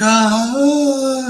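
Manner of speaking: put-on long high-pitched "ah" over a baby; loud, drawn-out imitation of cooing; mock coo. Older passengers seeing the baby, mock adoring coo.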